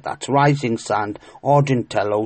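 usual speaking voice

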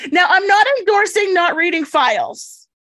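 ordinary talk, nothing marked

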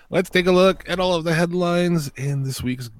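drawn-out segment intro; announcer voice. Acted. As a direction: announce it like a jingle. News segment intro jingle.

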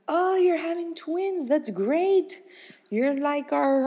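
high-pitched, drawn-out mock congratulations; imitating cheery doctor. Doctor congratulating a shocked couple on twins, imitated cheery delight. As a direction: play an upbeat doctor, pitched well up.

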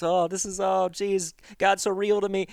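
mock-tearful, pretending to be moved; fake crying. A camper fakes tears at worship night, hammed-up mock crying. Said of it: "I started fake crying".

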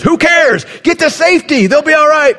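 the panicked amygdala shrieks; frantic high shout. Pretend. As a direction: scream selfishly to run for safety. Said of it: "your amygdala goes".

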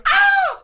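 high, loud terrified yelp; mock scream. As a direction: scream like something jumped out. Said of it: "over there going".

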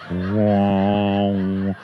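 very low, loud drawn wow; deadpan deep wow like a crowd; mock awed wow. Comic drops a deep booming wow.

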